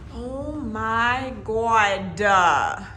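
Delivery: very slow, awestruck exclamation; drawn-out amazement. Real, not acted. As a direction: stretch every word in wonder. Amazed on seeing the room.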